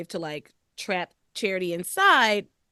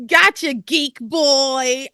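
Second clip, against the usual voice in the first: mock Timmy taunt; 6 semitones higher, 9 dB louder, much slower than usual. Host repeats the favourite line.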